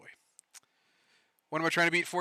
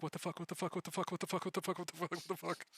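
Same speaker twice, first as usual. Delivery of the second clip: teeth chattering; 4 semitones higher, 13 dB quieter than usual. Retold or imitated. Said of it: "her teeth are chattering".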